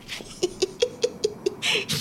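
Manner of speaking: high breathy giggle; mother laughing at the new thief; wheezing laugh. Acted. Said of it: "لا تضحكي".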